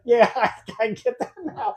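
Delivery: laughing through the words as you admit it; laughing while agreeing. Retold or imitated. Man admits his mistake to his coworker, laughing. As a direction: concede with a laugh. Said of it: "I'm laughing as he's saying it".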